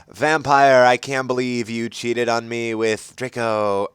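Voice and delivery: understated accusation; a touch of anger; mild mock shout. A narrator's reading. Ebony accusing Vampire in class, understated anger. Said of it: "I shouted at him".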